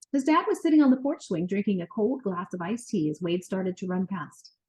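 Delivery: usual speaking voice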